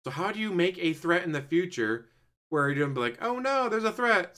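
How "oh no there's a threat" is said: mock panic. Mock hero voice.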